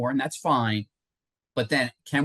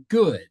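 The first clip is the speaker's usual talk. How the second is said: "good." said louder and higher as the key word; stress on good; 4 semitones higher, 6 dB louder than usual. Shown as a demonstration. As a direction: lean hard on "good.". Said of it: "I emphasize the word".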